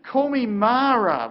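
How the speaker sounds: high, loud lament, slowing on the second half; a preacher voicing a grieving widow's outcry; anguished plea. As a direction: cry out her new name in grief. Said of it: "here is Naomi weeping".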